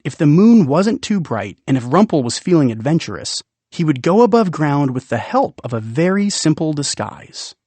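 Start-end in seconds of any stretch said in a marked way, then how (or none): none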